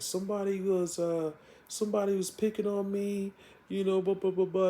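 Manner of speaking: a hint of a tearful girl telling her aunt; mild crying voice. Sabrina telling her aunt about bullies, mildly tearful and high. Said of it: "she comes home crying".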